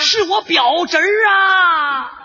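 high, very stretched-out call. Acted. Soldier claiming kinship across the lines, calling out. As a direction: holler it with long drawn vowels.

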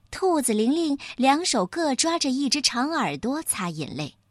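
ordinary delivery

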